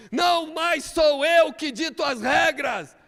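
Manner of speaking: preacher shouting his climax over the music; preaching at full force. Real, not acted. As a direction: preach it to the rafters.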